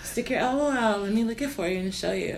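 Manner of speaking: soft teasing sultry voice; breathy sex-line purr; mock seductive. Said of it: "She sounds like a".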